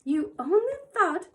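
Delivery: put-on shrill old woman pouncing on a word; terrible voice. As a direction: throw her words back at her, sneering. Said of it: "in her soft, terrible voice".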